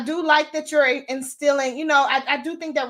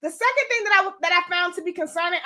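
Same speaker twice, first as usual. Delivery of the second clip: normal talking voice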